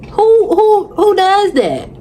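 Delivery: can't believe what she just heard; incredulous. Real, not acted. Outraged at the apology.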